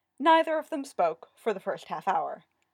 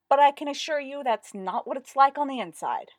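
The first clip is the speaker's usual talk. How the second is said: louder, higher, controlled insistence; strained restraint; 6 semitones higher, 6 dB louder than usual. A narrator's reading. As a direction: insist while holding irritation back. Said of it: "trying not to grit his teeth".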